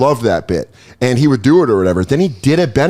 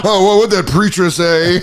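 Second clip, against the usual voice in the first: mocking impression; 7 semitones higher than usual. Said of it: "It's like".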